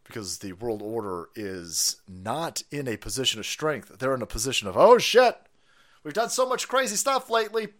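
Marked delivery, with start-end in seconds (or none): 4.8-7.7 mock panicked elites.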